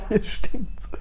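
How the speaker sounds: echoing it through laughter; laughing. Real, not acted. Host laughs repeating what someone said entering, laughing. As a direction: giggle the words out. Laughing echo of intruder's remark.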